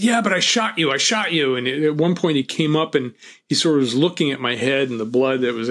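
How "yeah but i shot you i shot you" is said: the gunman protesting that he shot him; bewildered insisting. Retold or imitated. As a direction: insist in frightened disbelief.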